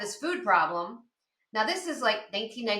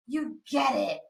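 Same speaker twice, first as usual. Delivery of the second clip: gasp, then an amazed hushed line; awed realization; slower than usual. Retold or imitated. Patient to yoga instructor who understands, awed. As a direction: act out being suddenly, finally understood.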